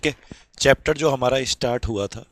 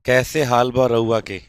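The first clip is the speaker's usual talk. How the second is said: Bhojpuri greeting; slower than usual. Shown as a demonstration. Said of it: "Bhojpuri में बोल के दिखाएंगे आज".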